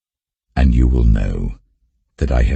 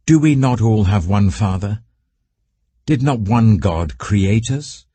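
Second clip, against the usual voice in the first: higher, pressing reading voice; raised scripture reading; 6 semitones higher than usual. Acted. Reader voicing the prophet's rebuke to Judah, higher and pressing.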